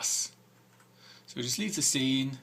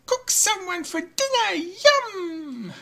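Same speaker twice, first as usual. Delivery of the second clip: very high, louder squeaky witch voice; the witch gloating about cooking someone, high and squeaky; muttering witch; 8 dB louder than usual. Acted. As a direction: gloat over dinner like a fairy-tale witch. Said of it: "The witch mutters".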